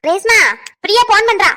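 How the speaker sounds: loud call about the phone. Acted. Mother-in-law calling Resma to take Priya's call, loud. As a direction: shout through the house that the phone is ringing.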